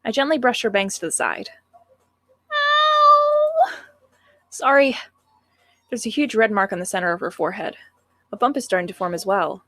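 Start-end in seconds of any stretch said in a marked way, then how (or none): 2.5-3.7 high, loud ows in pain; pained whimpering. Acted. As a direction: wail each ow like a hurt child. Bangs brushed on bump.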